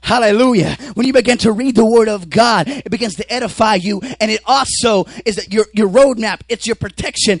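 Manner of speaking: loud, high-pitched sermon shout; full-force preaching. Acted. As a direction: preach it at the top of your voice.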